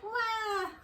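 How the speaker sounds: short high mock baby wail; a baby's brief cry, mimicked; imitated baby cry. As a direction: squeal a quick newborn wah. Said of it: "she went like".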